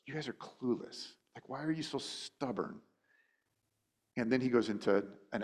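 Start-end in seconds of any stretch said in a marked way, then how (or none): 0.0-2.8 mock exasperation. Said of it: "Jesus looks at him and goes".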